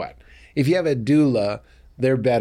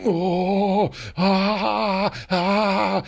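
imitating labor moans; 8 semitones higher, much slower than usual. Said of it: "she'd be like".

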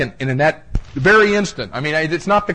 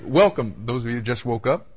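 usual speaking voice

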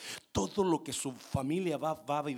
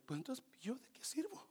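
whispered, then a higher, mocking "fuchi, fuchi"; mock wife's objection; 10 semitones higher than usual. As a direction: play the scornful wife lightly. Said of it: "Quizás me dirá, mujer".

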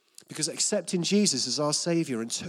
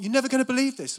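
much higher and louder, thrilled; excited mum; 10 semitones higher, 7 dB louder, faster than usual. Retold or imitated. Mum teasing her son with news, high and thrilled. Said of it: "And I was like".

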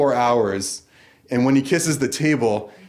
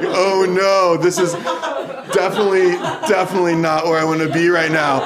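half-laughing, a little higher and louder; giggling in mock dismay; laughing nervous quote; 5 semitones higher, 4 dB louder than usual. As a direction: laugh through your own panic. Nervous laughing at brothel reveal.

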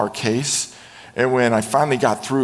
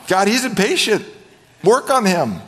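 higher-pitched mock plea; imitated complaining prayer; 8 semitones higher, 3 dB louder than usual. Wife telling God on her husband, pleading.

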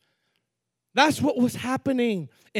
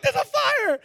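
much higher, excited alarm; alarmed shout. Retold or imitated. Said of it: "I'm like".

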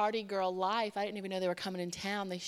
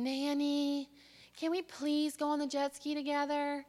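higher, sweet imitation of a child; pleading child; 5 semitones higher than usual. Child asking grandmother to ride together, pleading voice. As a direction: plead like a hopeful little girl.

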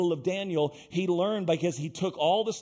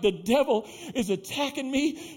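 higher, hammed-up whine of distress; mock whining; 5 semitones higher than usual. Woman tells pastor of devil's attack, imitated whining. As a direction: whimper it in exaggerated misery. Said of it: "down here wailing and whining".

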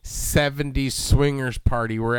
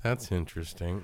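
polite dismissive; 8 semitones lower, 12 dB quieter than usual. Shown as a demonstration. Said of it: "And not interesting, like".

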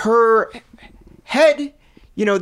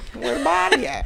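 put-on cop voice, higher; cop impression; 5 semitones higher than usual. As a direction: play a goofy cop demanding answers. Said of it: "I was doing a cop's impression".

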